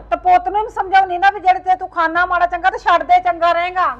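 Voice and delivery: stern lecture. Acted. Mother lectures son.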